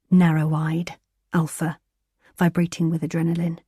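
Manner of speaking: ordinary delivery, nothing marked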